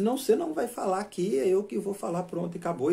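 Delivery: high-pitched imitation of a shouted argument; mock yelling. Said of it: "começaram a gritar mais alto".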